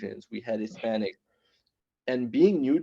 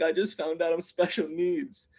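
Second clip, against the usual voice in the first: higher, mildly whiny mock upset; retold tearful upset; 5 semitones higher than usual. Crying kid confides in his other brother, mildly mock-tearful. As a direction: lightly imitate a crying kid. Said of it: "why are you crying".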